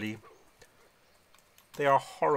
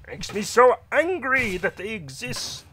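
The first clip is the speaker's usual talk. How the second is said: high, louder, seething outrage; indignant rage; 9 semitones higher, 9 dB louder than usual. Acted. Paladin rants about hating the kobolds. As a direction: let the anger boil over.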